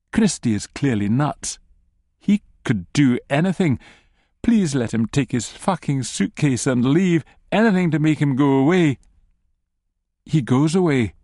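ordinary delivery, nothing marked

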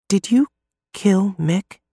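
high, hushed, tentative question; whispered question. A narrator's reading. Narrator confronting Fiore about Mick, whispering. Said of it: "I whisper".

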